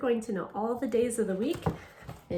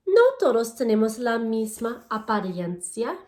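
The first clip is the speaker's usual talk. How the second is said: louder, clear storybook reading to a class; reading to children; 6 dB louder than usual. Acted. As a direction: read each page out to the kids, bright and paced. Reads Spanish picture book aloud.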